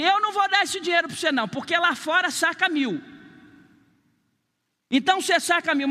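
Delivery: a bank teller refusing, high and curt; snippy bank teller. Retold or imitated. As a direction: brush the customer off briskly.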